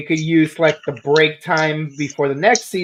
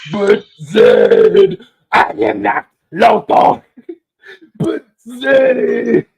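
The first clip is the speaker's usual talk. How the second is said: villain cry; 8 semitones higher, 5 dB louder, much slower than usual. Retold or imitated. Villain line acted again.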